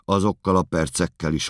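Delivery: ordinary talk, nothing marked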